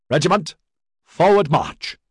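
high, loud parade-ground order; shouted command. A narrator's reading. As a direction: call out the drill order to a whole regiment. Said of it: "Echoing down the street came the command".

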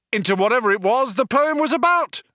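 high, fast triumphant yell. A narrator's reading. Ford leaping in to finish, yelling. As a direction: rush it out pitched right up. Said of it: "he yelled".

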